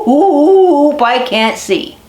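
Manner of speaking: the blinded wolf cries out he can't see. Acted. Storyteller as blinded wolf, startled high cry then plain.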